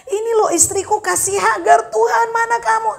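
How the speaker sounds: high, urgent plea to God; Abraham under pressure. Retold or imitated. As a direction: cry out as if cornered. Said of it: "ketika Abraham dalam tekanan".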